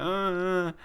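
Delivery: a high, frightened whimper. Retold or imitated. Hobob crying on the walk home, high whimper. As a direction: let a scared little whimper slip.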